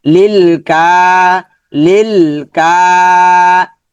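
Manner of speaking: slow লিল, then stretched কা; elongated কা. Shown as a demonstration. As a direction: hold কা long on a steady tone after লিল. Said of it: "কাফটা লম্বা হবে".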